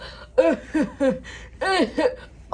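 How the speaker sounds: cackling villain laugh; evil laugh. Acted. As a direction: laugh wickedly at the victim.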